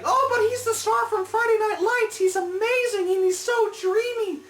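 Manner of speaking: high, loud mock swooning fan; hammed-up high fangirl gush. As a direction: squeal it like a starstruck fan gushing over her crush. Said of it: "a lot of people are saying".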